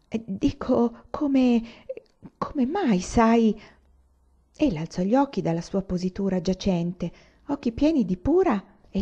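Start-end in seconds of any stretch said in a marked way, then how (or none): 0.1-3.6 stammering. A narrator's reading. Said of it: "balbettò qualche cosa".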